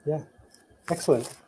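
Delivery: normal talking voice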